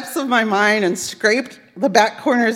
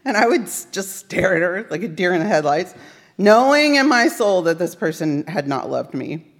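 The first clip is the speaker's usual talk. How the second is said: ordinary talk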